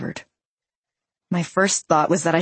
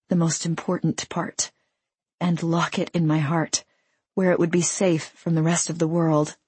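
ordinary delivery, nothing marked

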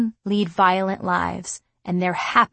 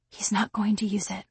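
soft whisper to herself; 6 dB quieter than usual. Acted. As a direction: breathe it as self-reassurance. Narrator calming herself.

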